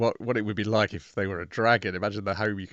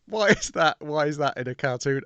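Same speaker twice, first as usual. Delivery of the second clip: puzzled exclamation; 6 semitones higher than usual. Retold or imitated. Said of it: "Which I was like".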